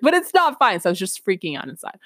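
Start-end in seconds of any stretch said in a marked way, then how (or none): 0.0-0.8 higher, louder inner panic; panicked outburst. Retold or imitated. Student freaking out inside, a higher louder cry. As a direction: burst out with the truth. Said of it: "I was like".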